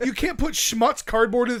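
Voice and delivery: ordinary talk, nothing marked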